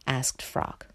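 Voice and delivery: plain reading voice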